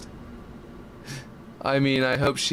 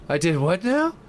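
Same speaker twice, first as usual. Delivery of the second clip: incredulous surprise; 9 semitones higher, 4 dB louder than usual. Real, not acted. Chat accuses him of slur.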